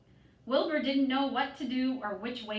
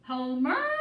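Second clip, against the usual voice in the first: calling out; 2 semitones higher, 5 dB louder than usual. A narrator's reading. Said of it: "she cried".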